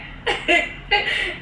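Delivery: high, bursting laugh at the memory. Real, not acted. Laughing at her own story, a bursting laugh. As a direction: laugh out at how absurd it was.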